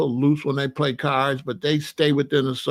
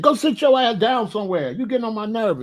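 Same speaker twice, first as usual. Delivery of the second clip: higher-pitched, exasperated order; mock scolding; 5 semitones higher than usual. Owner retelling orders to his Rottweiler, mildly exasperated scolding. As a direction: scold a dog like a kid. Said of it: "I talked to my dogs just like I talked to the kids".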